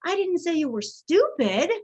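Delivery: "stupid" punched with a high swoop; stress on STUPID. Shown as a demonstration. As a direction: lean hard on "stupid", implying another word. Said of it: "we're using our emphasis".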